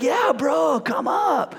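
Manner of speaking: high, hyped-up skater invitation. Retold or imitated. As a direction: imitate an excitable bro, ending in a whoop. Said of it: "He's like".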